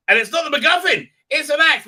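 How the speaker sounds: high, loud, fast outburst; mock shock. Real, not acted. Reviewer recapping the twist, with a loud shocked outburst. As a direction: blurt it out in disbelief.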